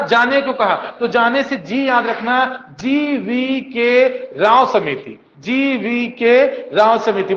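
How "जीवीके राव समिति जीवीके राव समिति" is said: GVK Rao समिति spelled out slowly for memorising; slow drilled name. Shown as a demonstration. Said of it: "बोलो एक बार". (रटवाने के लिए धीरे-धीरे)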